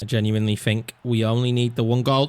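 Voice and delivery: usual speaking voice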